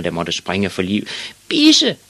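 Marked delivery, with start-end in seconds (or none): 1.5-2.0 very high, loud screech; high-pitched angry cry; old lady's shout. A narrator's reading. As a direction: yell it as an outraged old lady. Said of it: "råbte den gamle dame".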